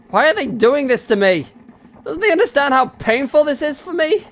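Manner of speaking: high, loud distressed questioning; desperate, pained outcry; anguished plea. Acted. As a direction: wail the questions, voice climbing high. Anguished outburst in story.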